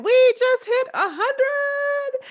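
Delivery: high, slow and drawn out with delight; thrilled, drawn-out announcement; excited. Real, not acted. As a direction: stretch it out like a big celebration. Celebrating the hundredth episode.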